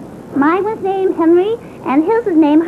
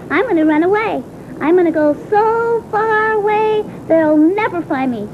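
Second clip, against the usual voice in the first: dramatic vow. Acted. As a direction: stretch each far-off place. Child vows to run away.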